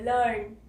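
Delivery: लर्न said with a short uh vowel, lurn; model pronunciation. Shown as a demonstration. Teacher modelling लर्न with the short vowel. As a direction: say लर्न with the अ sound, not बड़ी ई. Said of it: "इसको हम अ बोल रहे हैं".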